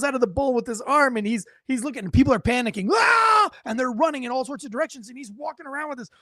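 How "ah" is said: sudden high yelp of panic; panicked scream. Retold or imitated. Acting out the bystanders panicking at the broken arm, a high scream. People panicking at the skate park.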